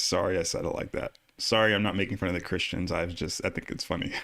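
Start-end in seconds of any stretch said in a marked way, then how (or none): none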